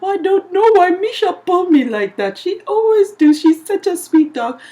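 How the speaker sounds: high-pitched, mocking imitation of a man; whiny-comic high voice of protest; bewildered dog owner.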